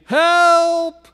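long, high-pitched cry for rescue; crying out for help. A narrator's reading. Missionary whom no one could hear, crying out for help. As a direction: stretch the word out high like a distant call. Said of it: "he's crying out".

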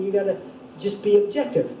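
ordinary delivery

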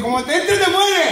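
loud, rising doom warning; mock warning. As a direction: scare him with a rising shout. Médico asusta con la gota. (advertencia exagerada del médico)

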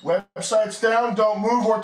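loud urgent call to the room; shouting. Real, not acted. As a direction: yell it across the set. Producer calls out to everyone.

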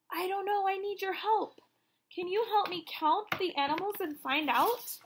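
ordinary talk

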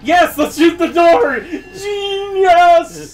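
high mock-excited sarcasm; sarcastic praise. Real, not acted. Gamer mocks friend's door advice, high sarcastic cheer.

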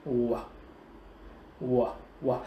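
low "ùa", spaced like toad calls; toad-like W glide. Shown as a demonstration. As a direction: repeat "ùa" like a toad croaking. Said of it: "con cóc nó kêu ấy". (đọc "ùa" trầm, ngắt quãng như cóc kêu)

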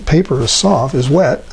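ordinary talk, nothing marked

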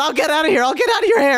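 high, loud frantic pleading; panicked retreat. Acted. Customer promising the Enderman to leave, high frantic cry. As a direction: back off loudly, voice climbing.

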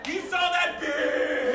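loud, high, drawn-out yell; hammed-up scandalized holler; outraged shout. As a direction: shriek it slowly in mock outrage. Acted outrage over ex sighting.

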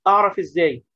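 usual speaking voice

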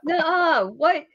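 high, loud mock disbelief; appalled. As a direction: refuse in exaggerated shock. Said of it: "And I was appalled".

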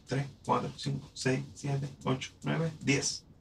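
soft count under the breath; murmured tally; quiet count. Shown as a demonstration. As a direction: count syllables to yourself. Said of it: "counting syllables".

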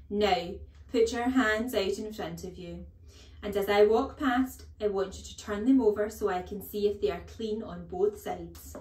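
loud, imperious instructions; strict headmistress running an inspection; commanding headmistress. A narrator's reading. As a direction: order a class about sternly. Headmistress orders hand inspection.

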